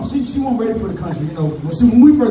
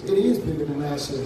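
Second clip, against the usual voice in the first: grandma's quiet insistence; 7 semitones lower, 7 dB quieter than usual. Retold or imitated.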